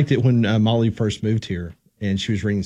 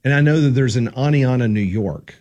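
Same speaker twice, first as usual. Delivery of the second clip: ordinary delivery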